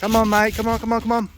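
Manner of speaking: high, eager urging to the bird; urgent calling. Real, not acted. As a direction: coax it in, quick and excited. Urging the pigeon to land.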